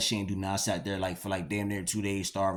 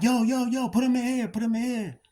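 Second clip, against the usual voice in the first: much higher, urgent calls to the guards; excited calling out; 13 semitones higher than usual. Retold or imitated. Teen calling to the guards to put his friend in, much higher and eager. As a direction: call out eagerly so they put your friend in your cell.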